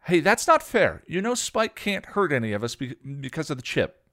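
high, loud defiant argument; arguing. A narrator's reading. Dawn argues with Xander defending Spike. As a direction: push back hard for Spike. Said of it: "Dawn argued".

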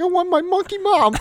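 high, hammed-up wail for mom; panicking child. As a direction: squeal it like a distraught kid. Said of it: "I was, like, panicking".

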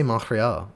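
"Montreal" with silent t and French r; French pronunciation. Shown as a demonstration. Host defending himself to co-host, "Montreal" pronounced in French. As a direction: demonstrate "Montreal" as French speakers say it. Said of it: "that's how you say Montreal".

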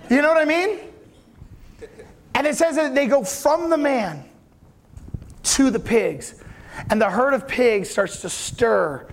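usual speaking voice

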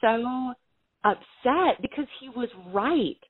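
fighting back real emotion; emotional. Real, not acted. Recalling crying in front of her brother, slow and emotional.

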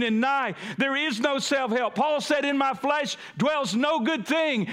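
preacher to congregation, proclaiming Jesus at full force; fervent preaching. Acted.

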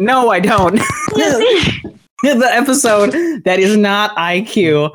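high, laughing and wired; giddy, can't stop laughing; high energy. Real, not acted. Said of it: "this is a little bit more high energy".

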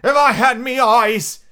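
very high, loud, drawn-out pirate cry. A narrator's reading. Blind Pew despairing of his eyes, crying out loudly. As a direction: wail it out slow at full voice. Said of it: "he cried".

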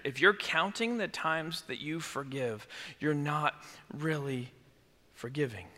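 soft and low for weight; hushed point. Real, not acted. Pastor telling the church softly. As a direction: let the point land quietly.

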